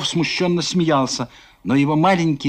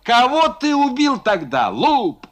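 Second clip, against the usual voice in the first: mocking rant; 5 semitones higher than usual. Foma mocks the merchants loudly.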